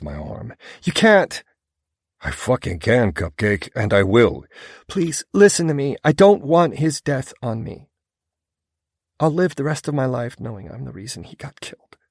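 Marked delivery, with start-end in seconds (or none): 0.8-1.4 high, loud desperate cry; pleading shout. Acted. She stops him leaving. 2.2-4.5 angry. Acted. Furious man vows revenge. 4.9-12.0 higher female voice, urgent plea; pleading woman. A narrator's reading.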